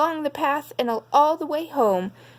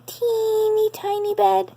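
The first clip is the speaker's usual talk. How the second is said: much higher, tiny little voice for the small bed; 12 semitones higher than usual. A narrator's reading. Narrator reading to children, tiny high voice on the little bed. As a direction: shrink the voice way up on teeny tiny.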